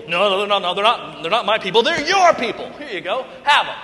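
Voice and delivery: loud, playful insistent protest; hot potato retort. Acted. God insists to Moses, loud and playful, they're his people. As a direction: shove them back, half joking. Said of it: "played hot potato back and forth".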